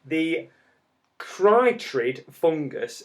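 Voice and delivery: very slow, deliberate pronunciation; cautious, drawn-out syllables; said carefully. Real, not acted. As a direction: pick through a tricky term. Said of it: "gonna have to say this carefully".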